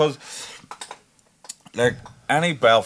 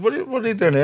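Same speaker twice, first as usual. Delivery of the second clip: much higher, mock-surprised question; mock surprise; 11 semitones higher, much faster than usual. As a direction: hit the question high, playfully surprised. Retold question to rapper.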